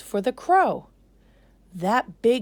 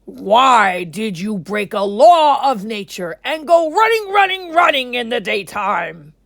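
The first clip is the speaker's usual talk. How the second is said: much louder, booming shout; king's cry; 4 semitones higher, 12 dB louder than usual. Acted. King Lion crying out to the rabbit, booming. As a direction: call the rabbit like an angry king. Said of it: "cried the king".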